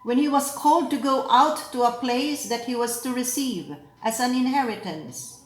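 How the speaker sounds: measured, solemn proclamation with deliberate pauses; lector reading scripture. Acted. Lector proclaiming the readings to the assembly, solemn.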